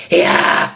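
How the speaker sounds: forced breathy yell; a strained attempt at a full scream; a mock try-hard scream; wrong scream attempt. Shown as a demonstration. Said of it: "instead of trying to go like".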